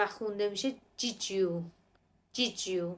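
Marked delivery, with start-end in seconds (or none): 1.0-2.8 did you blended as "didju"; linked pronunciation. Shown as a demonstration. Teacher showing kids how did you is linked.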